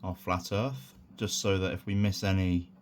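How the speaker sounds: ordinary talk, nothing marked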